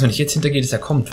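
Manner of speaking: ordinary talk, nothing marked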